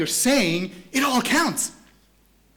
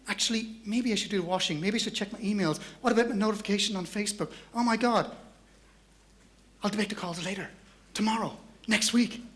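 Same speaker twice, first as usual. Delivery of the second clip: softer, wavering excuses to oneself; sheepish self-talk; 2 semitones higher, 6 dB quieter than usual. Acted. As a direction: talk yourself out of it, quietly. Acted caller giving in to distraction.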